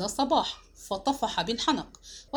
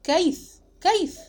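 high, loud one-word demand; unfamiliar, stunned voice; 8 semitones higher, 4 dB louder, slower than usual. A narrator's reading. Husband demanding answers from the mother, stunned and high. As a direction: fire it out high and loud, as if the voice were a stranger's. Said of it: "وسألتها بصوت كنت أسمعه لأول مرة".

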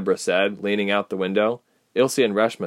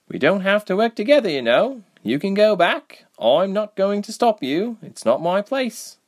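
put-on Reshma, earnest young rebel; 12 semitones higher, 3 dB louder than usual. A narrator's reading. Reshma approaching the crying Ilse, earnest. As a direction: lift the voice high and press the point gently.

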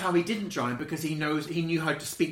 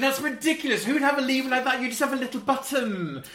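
scoffing disbelief; 7 semitones higher, 4 dB louder than usual. Retold or imitated. Retelling own cynical thoughts.

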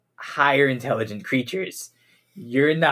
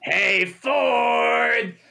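calling out; 11 semitones higher than usual. Acted. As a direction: yell for Ford to come over. Calling Ford from afar.

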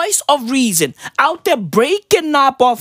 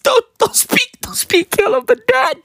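much higher, cracking delivery; high tearful squeak; 10 semitones higher than usual. Acted. Comic repeating mama's warning about the dead, high and tearful. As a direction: squeak it out as if near tears.

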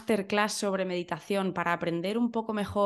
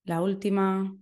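guided meditation. Acted. Leads a breathing meditation.

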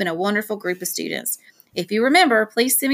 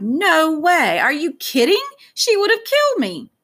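high, louder exclaiming; wide-eyed disbelief; 9 semitones higher, 4 dB louder than usual. A narrator's reading. Dad asked whether mom knows, aghast disbelief. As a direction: exclaim in comic alarm at the very idea.

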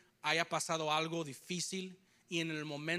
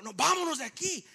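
mock urgent order; 7 semitones higher, 4 dB louder than usual. Older man ordering retreat.

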